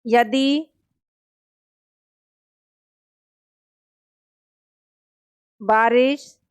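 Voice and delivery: one word at a time with long pauses for writing; slow classroom dictation, word by word; dictating word by word. Shown as a demonstration. Teacher dictates a second example to students, slowly. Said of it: "दूसरा example लिखेंगे".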